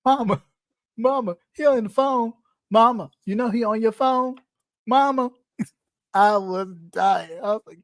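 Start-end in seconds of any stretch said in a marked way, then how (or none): 0.1-5.3 imitating a whiny kid. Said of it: "the way she was like".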